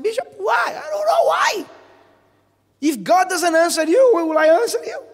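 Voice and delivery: high, loud, desperate cry of why; pleading shout. Retold or imitated. Congregant demanding answers from the bishop, shouting high. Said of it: "many of you come to us with this question".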